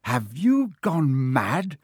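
drawn-out, exasperated bellow. A narrator's reading. Ron berating Hermione while trapped, bellowing. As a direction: bellow in disbelief, stretching each word. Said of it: "Ron bellowed".

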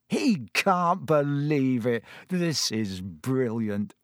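Ron's voice, slow and delighted; gleeful. A narrator's reading. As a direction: savour every word with glee. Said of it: "said Ron gleefully".

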